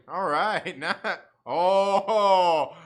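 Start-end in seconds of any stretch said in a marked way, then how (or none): 1.4-2.8 a sudden loud oh, caught off guard; loud surprised oh. Real, not acted. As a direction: blurt it out.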